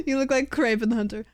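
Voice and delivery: usual speaking voice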